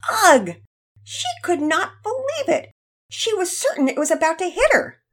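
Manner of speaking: high, loud, indignant narration; exasperated disbelief. A narrator's reading. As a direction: tell it as the outraged girl would. Narrator voices Penelope's outraged disbelief.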